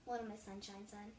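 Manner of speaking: ordinary delivery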